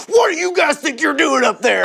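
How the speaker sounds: angry dad. Retold or imitated. Club owner catches the band on the roof, scolding dad shout. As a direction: bellow like a dad catching kids in trouble. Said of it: "you're in trouble dad move".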